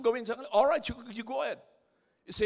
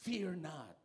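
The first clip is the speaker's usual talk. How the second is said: softer, calming "Fear not"; gentle Joseph; 4 semitones lower, 9 dB quieter than usual. Retold or imitated. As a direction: reassure the frightened brothers softly. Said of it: "Sabi ni Joseph".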